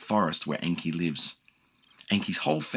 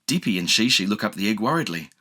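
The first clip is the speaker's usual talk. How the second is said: usual speaking voice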